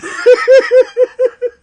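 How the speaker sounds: loud high burst of real laughter. Real, not acted. Host laughs at movie memory.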